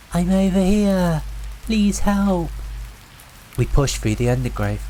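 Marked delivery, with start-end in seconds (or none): 0.1-2.5 high, slow, pleading call; lost woman calling. A narrator's reading. Voice in the woods luring the campers, high and pleading. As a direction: call slowly from far off, begging. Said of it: "The voice called again".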